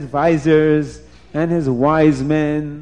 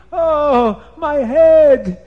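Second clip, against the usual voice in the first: high, loud wails with stretched words; Nimrod screaming in pain; 13 semitones higher, 9 dB louder, much slower than usual. A narrator's reading. Nimrod pecked by the bird, screaming in pain. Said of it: "Nimrod starts to scream".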